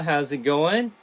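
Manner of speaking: normal talking voice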